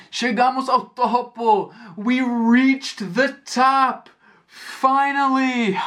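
high, slow triumphant cry. Retold or imitated. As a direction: announce the summit with relief. Reaching the summit, out of breath.

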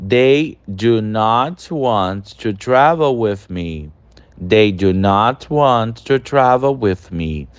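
slow, clear teacher read of an English example; slow model sentence. Shown as a demonstration. Teacher reading the third example to students, slow and clear. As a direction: read it slowly so students can repeat. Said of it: "Terceiro exemplo".

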